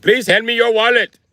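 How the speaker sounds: high, loud mock Indian accent. Indian robber demanding a wallet, high mock accent. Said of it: "Can you imagine a robber being like".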